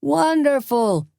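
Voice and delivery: high slow delighted cry. A narrator's reading. A crowd voice praising the show, high slow delighted cry. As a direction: call it out in raptures. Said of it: "another voice cried".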